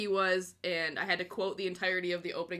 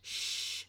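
long unvoiced shush; mock shush to keep a secret; hushing; 8 dB quieter than usual.

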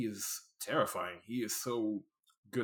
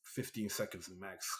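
usual speaking voice

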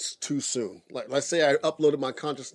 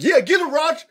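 loud high egging-on shout; cheering on; 13 semitones higher, 7 dB louder than usual. Pretend. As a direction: yell encouragement from the sidelines. Playacting cheering from corner.

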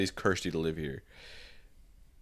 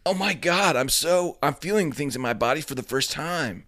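dramatic awakening; 7 semitones higher than usual. Acted. Acted evolving into new form.

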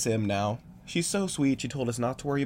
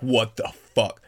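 loud, slow, voiced disbelief; aghast and emphatic; shocked exclamation; 7 dB louder, slower than usual. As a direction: say it out loud, stunned, stretching each word. Stunned reaction to changed voice.

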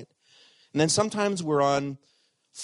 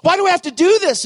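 high, loud cry of anguish; anguished lament; 10 dB louder than usual. Acted. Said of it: "He was lamenting".

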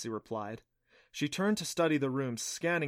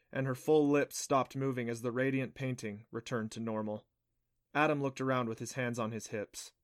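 ordinary delivery

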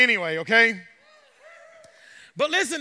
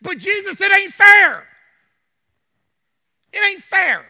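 put-on higher and much louder, like a child; protesting Peter; 8 semitones higher, 12 dB louder than usual. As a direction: cry out it isn't fair. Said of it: "he's saying".

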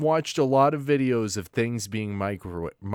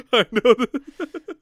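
laughing; 9 semitones higher, 3 dB louder, slower than usual. Real, not acted. Laughs at his own joke.

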